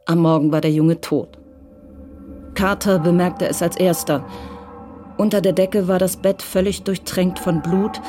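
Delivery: ordinary talk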